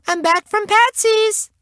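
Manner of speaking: put-on very high little-girl voice; high squeaky child's announcement; called out. A narrator's reading. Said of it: "she called".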